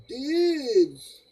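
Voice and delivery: high, loud surfer "Dudes!"; surfer dude shout. Shown as a demonstration. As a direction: belt it like a surfer. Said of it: "I sounded like a surfer just now".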